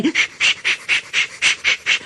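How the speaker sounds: whispered shh puffing rhythm; soft unvoiced chuffing; engine puffs. A narrator's reading. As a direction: puff like a steam engine.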